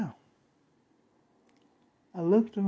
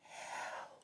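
whispered, drawn-out cry for help; barely audible, like screaming from inside a soundproof box; faint muffled scream; 23 dB quieter, much slower than usual. Retold or imitated. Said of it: "and I heard it".

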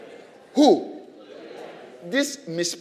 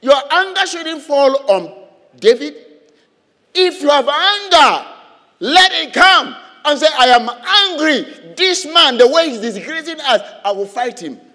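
preaching at full force; 7 semitones higher, 9 dB louder than usual. Real, not acted. Preacher urges the church to turn anger on the enemy, forceful. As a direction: drive it home from the pulpit.

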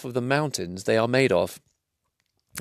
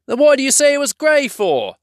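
high indignant troll; 5 dB louder than usual. Acted. Bert accuses William.

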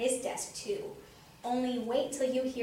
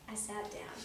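plain reading voice

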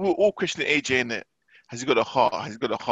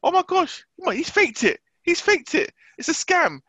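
much higher, squeaky impression of wife; mock the wife insisting it's a fake; wife claims the knockout was faked, mocked much higher; 11 semitones higher than usual. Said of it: "When did he got knocked out".

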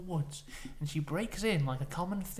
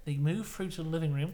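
usual speaking voice

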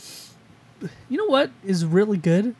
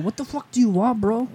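higher rude counter girl in a fake British accent; mock British accent; 6 semitones higher than usual. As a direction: sneer it in a bad British accent. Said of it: "Goddamn British accent".

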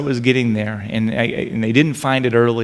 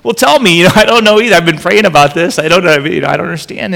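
eager reply; 5 semitones higher, 10 dB louder than usual. Retold or imitated. Vicar answers Gary eagerly.

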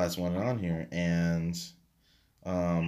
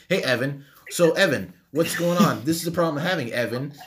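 higher-pitched, chirpy imitation of a caller; oblivious caller; 5 semitones higher, 5 dB louder than usual. Imitating customers who get his name wrong, chirpy caller. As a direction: mimic a chatty caller, pitched up.

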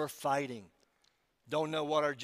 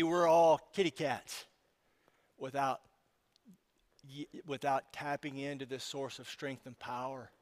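normal talking voice

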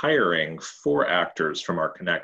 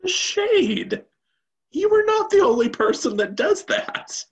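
high, loud playful indignation; mock outrage; 6 dB louder than usual. Real, not acted. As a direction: act scandalised, laughing it off. Mock offense at a jab.

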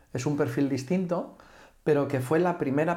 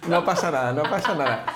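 laughing; 5 semitones higher, 3 dB louder, faster than usual. Real, not acted. Laughing self-deprecation.

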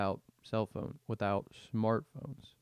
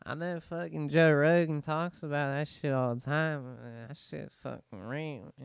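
put-on old-school smoker persona; 4 semitones higher than usual.

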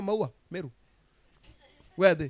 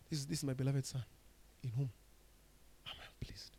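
hushed heavenly voice; 2 semitones lower, 8 dB quieter than usual. Acted. Voice heard from heaven.

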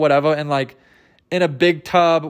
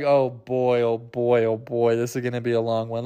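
weary typist; 8 semitones lower, 5 dB quieter than usual. Retold or imitated. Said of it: "the typewriter guy is like".

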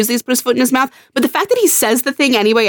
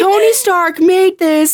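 mock movie quote; 7 semitones higher, much slower than usual. Quoting movie line mockingly.

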